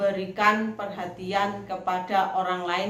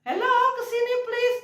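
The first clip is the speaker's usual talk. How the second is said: high, projected call. Shown as a demonstration. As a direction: wave people over with your voice. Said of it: "karena suara kamu". (panggilan lantang ke sini)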